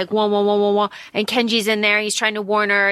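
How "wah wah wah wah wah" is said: repeated even wah-wah throb; imitated pulsing sound. Imitating the sound wave room's pulsing, rhythmic wahs. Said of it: "With the pulsing".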